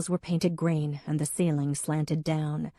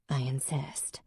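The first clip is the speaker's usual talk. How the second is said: softer, whispered; 3 semitones lower, 7 dB quieter than usual. A narrator's reading. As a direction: hiss it under the breath as she leaves. Em's cold parting line.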